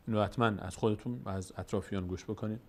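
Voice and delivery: ordinary delivery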